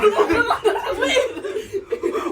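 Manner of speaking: high, loud burst of laughing; loud laughter. Real, not acted. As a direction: crack up loudly. Door closing on friend.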